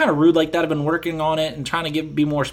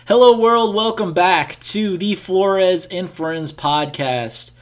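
podcast host intro; 4 semitones higher, much slower than usual. Acted.

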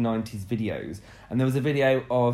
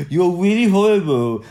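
exaggerated R-less mock complaint; mock speech impediment; 5 semitones higher, 7 dB louder than usual. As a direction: say Rs as Ws, hammed up. Trades R-as-W impressions.

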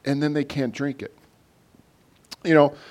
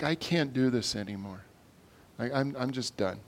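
acted exhaustion; 2 semitones lower, 9 dB quieter than usual. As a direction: quietly voice someone worn out and done. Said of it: "We're exhausted".

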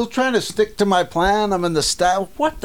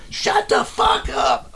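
much higher, slow shouted shut the fuck up; yelling from the back; slower than usual. Retold or imitated. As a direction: yell it across the whole store. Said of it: "from the back of the store, I'm going".

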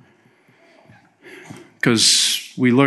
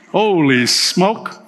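mock surprise; 5 semitones higher, much slower than usual. Mock alarm at own mistake.

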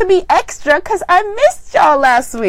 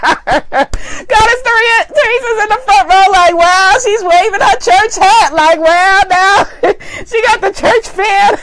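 higher-pitched, cracking up while talking; laughing; 5 semitones higher than usual. Real, not acted. Host teasing Goddess Teresa about the church fan, laughing. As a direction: laugh through the words.

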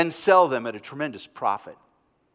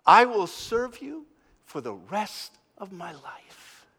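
devoted vow; 4 semitones higher than usual. A narrator's reading. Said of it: "began to cry out".

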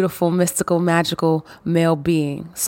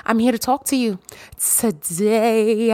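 ordinary talk

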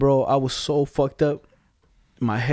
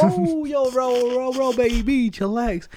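high, pitchy retold plea to calm down; mock soothing hype; 9 semitones higher, much slower than usual. Retelling how he calmed the phone owner's friend, high and pitchy. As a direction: squeal it out like you're talking someone down. Retold calming an angry caller.

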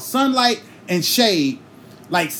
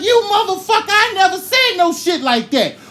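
high, loud outraged protest; outraged yell; 12 semitones higher, 4 dB louder than usual. Retold or imitated. He lied to mama about him, so a high outraged yell. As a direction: shout the denial at the sibling. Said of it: "I was like".

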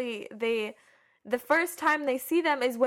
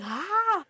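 high, drawn-out mock "ah"; short acted scream, pitched up; imitated cry; 8 semitones higher than usual. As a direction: mimic a startled film cry. Imitating the film character's cry.

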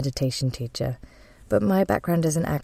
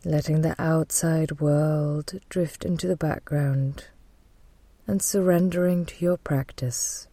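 guided meditation. Real, not acted. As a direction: guide them gently into calm, leaving space to breathe.

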